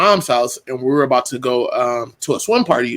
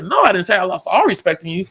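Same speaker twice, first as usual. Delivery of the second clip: rising protest; 7 semitones higher than usual. Retold or imitated. Retold self defending to wife.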